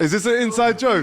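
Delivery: high, loud disbelief; squeaky mock outrage at the story; incredulous. Real, not acted. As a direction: shoot back in disbelief. Incredulous interruption.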